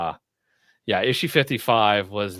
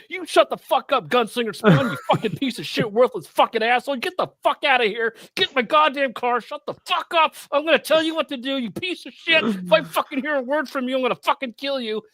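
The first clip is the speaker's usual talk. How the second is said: mock furious housekeeper; 13 semitones higher than usual. Housekeeper berating Gunslinger Spawn, high mock rage. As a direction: tear into him like an enraged maid.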